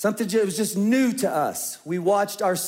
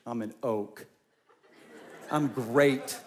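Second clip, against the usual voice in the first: mock-tough laughing; 6 semitones lower, much slower than usual. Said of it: "we're like".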